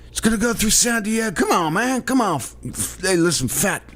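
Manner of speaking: high, breathy Biden voice; hammed-up old-man Biden; Biden impression.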